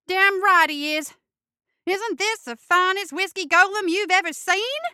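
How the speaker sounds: high, loud proud squeak. Acted. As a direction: squeal with pride over the golem. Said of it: "Ray squeaked".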